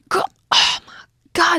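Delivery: normal talking voice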